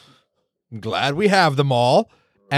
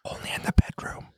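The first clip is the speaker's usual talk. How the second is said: breathy innuendo whisper; 9 dB quieter than usual. Acted. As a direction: whisper it like a dirty joke.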